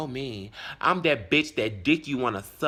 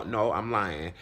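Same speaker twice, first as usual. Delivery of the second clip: ordinary talk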